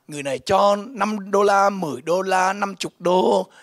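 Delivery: ordinary talk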